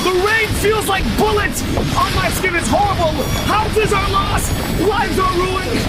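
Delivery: frantic, high reporter yelling over wind; overacting storm reporter. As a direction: pretend the hurricane is blasting you. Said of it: "acting like he was in the wind".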